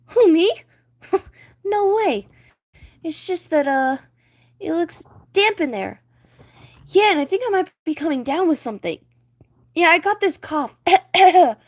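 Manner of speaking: high, loud panicky fish; scared Flounder. Acted. Flounder scared of sharks, high panicky voice. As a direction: fake bravado cracking into panic.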